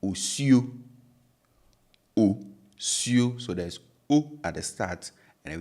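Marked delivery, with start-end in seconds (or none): 0.0-3.4 osuo with pure O at both ends; Twi word osuo. Shown as a demonstration. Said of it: "we have osuo".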